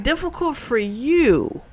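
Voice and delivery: slow, scornful throwback of the words; mocking retort, slowed down; mocking echo. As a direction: toss "Difficult" back at her with disbelief. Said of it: "she's like".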